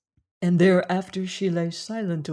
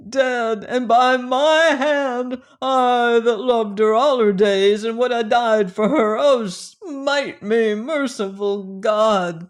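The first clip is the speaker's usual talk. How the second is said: anguished lament; 4 semitones higher, 5 dB louder than usual. A narrator's reading. Grieving man cries to God.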